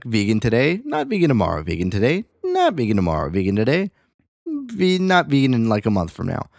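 today rising, not vegan tomorrow falling; sing-song contrast. Shown as a demonstration. Mocking fickle diets, rising and falling sing-song. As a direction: bounce between on and off, rising then falling. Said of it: "I could turn this on and turn this off".

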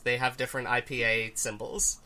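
normal talking voice

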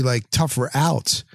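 normal talking voice

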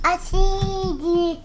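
ordinary talk

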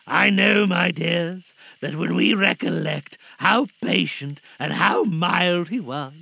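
loud, emphatic, tender-hearted speech; moved father Bob. Acted. Father to children remembering Tim, moved and earnest. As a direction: press each phrase home with feeling. Bob addresses his family.

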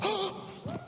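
high startled gasp; mock sharp gasp. Nurse seeing the healed breastbone, startled gasp. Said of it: "She goes".

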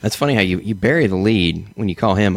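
normal talking voice

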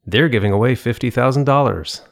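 "They're" hit high and hard; misplaced high stress on "They're". Retold or imitated. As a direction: punch "They're" as if it's the news. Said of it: "Why do you emphasize there? Why is there so high?".

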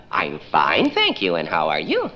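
lilting fine thank you, pitch dropping then leaping; sing-song fine. Acted. Caller humoring a toddler, sing-song. Caller answers child's question.

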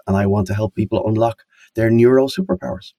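normal talking voice

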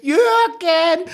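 put-on very high, fluttering old-lady voice; shrill granny calling for Jürgen; hammed-up old woman calling her husband.

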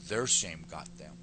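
ordinary delivery

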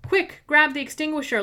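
loud, high urgent command; shouted. Acted. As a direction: bark the order in a panic. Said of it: "Lara shouted".

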